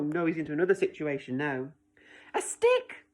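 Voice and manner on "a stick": put-on high excited little girl cry. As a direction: squeal it with delight. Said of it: "cries the girl".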